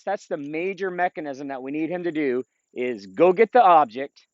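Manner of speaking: normal talking voice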